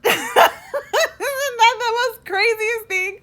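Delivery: high laughing cry of disbelief; laughing incredulous. Real, not acted. Vlogger marvels at her own complaint to God, laughing high. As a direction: squeal it through laughter. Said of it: "it's so insane".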